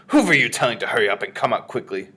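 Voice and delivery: loud, snappish witch; irritated witch's interrogation; snapping. A narrator's reading. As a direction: snap the question out loudly. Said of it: "snapped the Grand High Witch".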